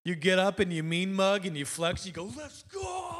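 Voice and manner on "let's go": high, held-back shout, much softer; mock victory yell. Preacher showing what you yell when winning, mock yell. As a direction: flex and yell it at reduced volume. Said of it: "a thing that you yell when your team is winning".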